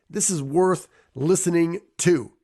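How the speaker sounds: ordinary talk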